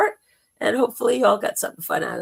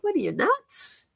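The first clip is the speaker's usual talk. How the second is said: high squeaky caterpillar voice; squeaky little critter scoffing; 13 semitones higher than usual. Retold or imitated. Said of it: "they would look at you like".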